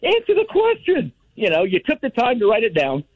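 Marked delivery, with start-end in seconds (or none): 0.0-1.1 high-pitched mock yell at the TV; exasperated shout at the TV; Will Ferrell yell. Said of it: "yell through the TV like Will Ferrell".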